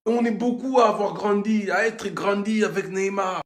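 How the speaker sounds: normal talking voice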